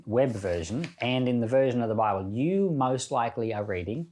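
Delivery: normal talking voice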